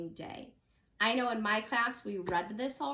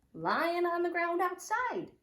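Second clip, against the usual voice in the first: ordinary delivery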